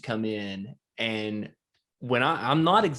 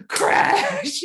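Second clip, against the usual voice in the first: much higher, louder mock crash noise; 7 dB louder than usual. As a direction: burst out with the crash. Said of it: "it was just like".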